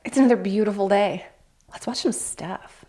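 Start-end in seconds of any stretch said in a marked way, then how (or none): 1.7-2.8 hushed, slow lead-in; hushed slow invitation to watch; soft intro. Acted. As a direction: draw them in quietly.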